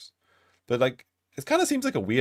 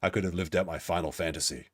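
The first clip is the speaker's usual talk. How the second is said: low, soft mock lament; mimicking smitten man; 7 semitones lower, 7 dB quieter than usual. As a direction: mutter it as a parody of his fantasy. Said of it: "He, like, just keeps talking about Anna".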